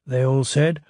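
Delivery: plain reading voice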